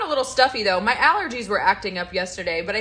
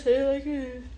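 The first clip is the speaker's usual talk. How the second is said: quoting a viewer; 6 semitones higher than usual. Retold or imitated. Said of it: "she says".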